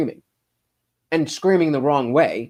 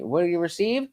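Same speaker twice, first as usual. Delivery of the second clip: usual speaking voice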